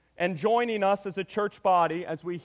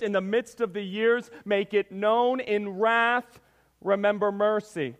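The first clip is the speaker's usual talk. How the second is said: raised, loud, declamatory Bible reading; scripture reading; 3 semitones higher, 3 dB louder than usual. Acted. Preacher reads Habakkuk's prayer to the church, forceful scripture reading. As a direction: proclaim the prophet's prayer with force. Reads the verses aloud a second time.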